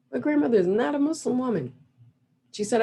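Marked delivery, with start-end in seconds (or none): none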